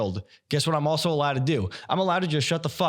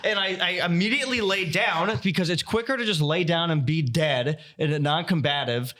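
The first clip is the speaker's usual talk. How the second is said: ordinary delivery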